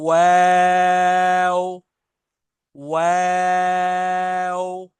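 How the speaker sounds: वाव drawn out very long, lips rounded then spread; rounded-lip letter. Shown as a demonstration. Teacher modelling the rounded-lip letter, slow. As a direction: hold the letter out slowly. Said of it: "आपके होंठ दोबारा अपनी position पे आएंगे".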